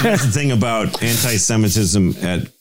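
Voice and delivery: ordinary talk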